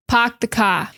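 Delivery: "park the car" as "pahk the cah"; Boston accent. Shown as a demonstration. Teacher showing students the classic Boston phrase. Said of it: "classic Boston thing".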